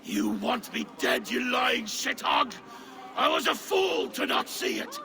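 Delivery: high, raging yell; furious shout. Acted. Poisoned king raging at his old friend, furious shout. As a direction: explode at the liar. Enraged king confronts traitor.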